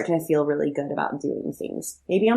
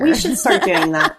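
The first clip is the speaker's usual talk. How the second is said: laughing; 12 semitones higher, 6 dB louder than usual. Real, not acted. Laughing agreement.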